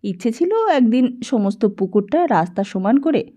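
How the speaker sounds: usual speaking voice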